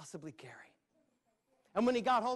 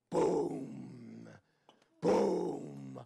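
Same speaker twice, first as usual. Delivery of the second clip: deep imitated boom of giant steps; booming footsteps; 5 semitones lower, 3 dB louder than usual. Storyteller making the giant's footsteps for children, booming. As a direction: thud it out like heavy footsteps.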